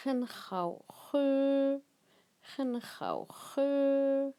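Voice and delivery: "Hen hao he" drawn out slowly with each syllable clear; teacher's careful slow "Hen hao he"; slow Chinese model phrase. Shown as a demonstration. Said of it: "teacher models Chinese phrase".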